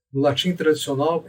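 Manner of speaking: usual speaking voice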